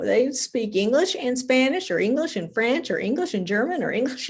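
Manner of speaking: swooping, emphatic amazement; marvelling. Real, not acted. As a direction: lift each language with admiring disbelief. Marvelling at bilingual speakers.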